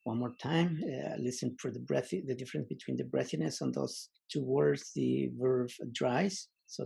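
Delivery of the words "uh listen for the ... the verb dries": usual speaking voice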